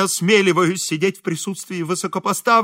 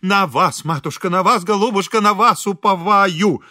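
higher, louder imploring chant; gushing pleading; 5 semitones higher, 5 dB louder than usual. A narrator's reading. Kneeling priest imploring the lady of the house, gushing. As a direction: plead insistently, drawing out the end. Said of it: "зачастил".

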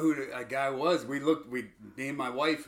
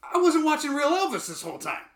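much higher, louder stunned realisation; retold surprise; 10 semitones higher, 5 dB louder than usual. Telling friend his movie shock, higher and louder. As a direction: gasp out the shock of the reveal.

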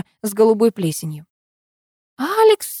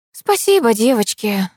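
mild sigh, starting high and falling; 4 semitones higher than usual. A narrator's reading. Narrator thanking her departing friends, sighing. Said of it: "вздохнула я".

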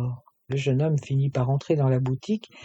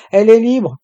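loud, high call from far across the shop; shouted; 7 semitones higher, 12 dB louder than usual. A narrator's reading. Océane calls from the back of the shop, shouting. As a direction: yell it out to be heard from the back. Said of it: "cria Océane du fond de la boutique".

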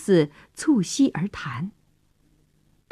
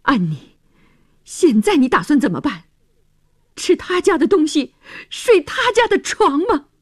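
rising, higher exasperated outburst; 6 semitones higher than usual. A narrator's reading. George angrily challenges Annie about staying, exasperated. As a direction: build from pleading to a high indignant climax. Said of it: "他气急败坏地注视着".

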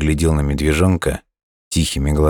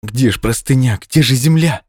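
higher, anxious questions; alarmed; 8 semitones higher, 3 dB louder than usual. A narrator's reading. Hare falling through darkness, alarmed. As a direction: pitch climbing in mild panic.

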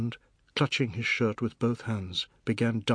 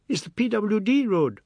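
put-on high, louder child's voice; defiant little boy; 11 semitones higher, 5 dB louder than usual. Anand talks back to the fat man, defiant little boy. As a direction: talk back in a high boy's voice.

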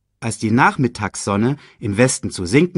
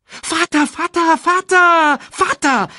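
put-on high, insistent child's repeated calling; urgent cub; 5 dB louder, slower than usual. A narrator's reading. Cub pesters his sleeping father, urgent high calls. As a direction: pester in a rapid high string, swelling. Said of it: "drängte Simba".

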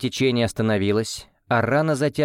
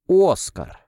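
loud, high exclamation; surprised; 8 semitones higher, 4 dB louder than usual. A narrator's reading. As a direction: cry out the name in shock. Brother suddenly appears.

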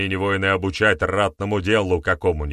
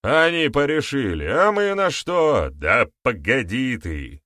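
indignant elder; 7 semitones higher, 4 dB louder than usual. A narrator's reading. Elders quarrelling at council.

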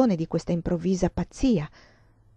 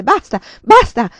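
loud, high cry of basta; shouting; 4 semitones higher, 13 dB louder than usual. Acted. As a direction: hurl the words at the girls, furious. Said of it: "gridò". (grida furiosa)

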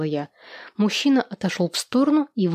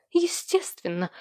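slow, higher, mildly smug; haughty agreement; 6 semitones higher, 3 dB quieter, slower than usual. A narrator's reading. Ekaterina answers Vladislav about the inheritance, haughty. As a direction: stretch it out in a raised pitch. Scornful reply in quarrel.

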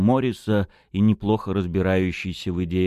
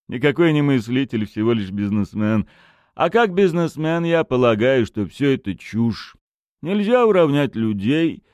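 blunt businessman; 5 semitones higher, 5 dB louder than usual. A narrator's reading. Bursar voiced, businessman.

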